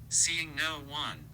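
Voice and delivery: plain reading voice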